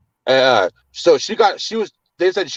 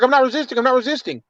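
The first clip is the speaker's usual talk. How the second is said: pitched-up insistent denial; insistent protest; 8 semitones higher, faster than usual. Retold or imitated. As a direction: insist you're innocent, voice raised in pitch. Protesting to the cop.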